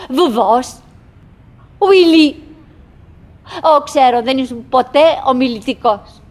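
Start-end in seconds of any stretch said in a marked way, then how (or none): 1.8-2.4 loud call across the stage. Acted. Wife calling her unanswering husband loudly. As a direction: call his name loud to make him answer.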